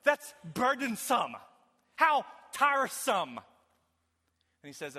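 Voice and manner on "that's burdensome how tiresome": higher and louder, mocking the complainers' words; full-force preaching. Pastor mocking the congregation's excuses, rising preaching.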